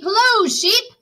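storybook duck hailing the sheep; cheery duck greeting. Acted. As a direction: call out brightly as the duck. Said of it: "said Duck".

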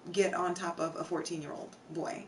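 normal talking voice

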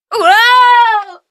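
high, loud shouted whoa of alarm; startled yell. Acted. Nancy is suddenly thrown off balance, startled loud yell. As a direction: yelp as the floor gives way.

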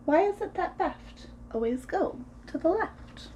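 ordinary delivery, nothing marked

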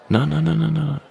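high, loud repeated no to block her; sharp stop. Acted. Stopping her from leaving.

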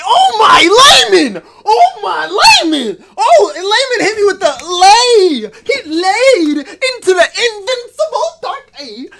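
shouting high through laughter; ecstatic screaming. Real, not acted. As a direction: lose it over the pull, laughing and screaming. Ecstatic reaction to winning pull.

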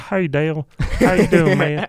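hammed-up Rockingham accent, eager greeting; younger self's Southern accent. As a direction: imitate your old Southern drawl, laying it on. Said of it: "then you hear me then".

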